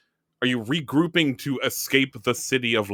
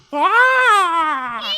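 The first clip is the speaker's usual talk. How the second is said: high screech like an alley cat; loud yowling "Ah!"; cat-like scream; 7 dB louder than usual. Shown as a demonstration. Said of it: "it's like a cat".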